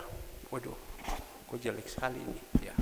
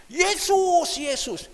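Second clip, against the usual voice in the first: high, loud jeering call from afar; mock the name across the pool; a neighbour's taunting yell, fading; mocking shout; 10 dB louder, much slower than usual. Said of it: "dia bilang".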